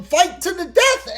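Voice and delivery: normal talking voice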